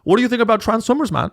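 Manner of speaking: high, fast stoner-bro voice; Joe Rogan impression. Mocking Rogan moderating a debate, high rambling bro voice. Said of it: "Joe Rogan will be like".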